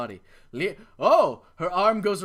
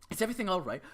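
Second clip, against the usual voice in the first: normal talking voice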